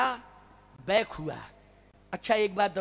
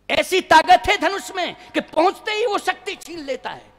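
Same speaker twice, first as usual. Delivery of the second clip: raised high, loud exclamation; emphatic warning; 8 semitones higher, 8 dB louder than usual. Acted. Defeated king warning others about the bow, loud and emphatic. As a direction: proclaim the danger at full voice.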